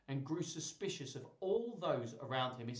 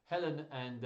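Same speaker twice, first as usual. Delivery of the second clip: usual speaking voice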